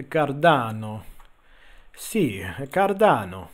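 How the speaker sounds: playful mock Italian-style accent; drawn-out Cardano in a mock Latin accent; put-on Latin accent. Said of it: "I always say it with that accent".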